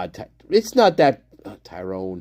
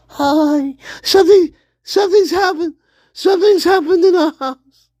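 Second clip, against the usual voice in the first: high, distressed little voice; high put-on character; 14 semitones higher, 5 dB louder than usual. Little character reporting trouble at home, high put-on voice. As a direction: squeak out the news, hesitant and repeating.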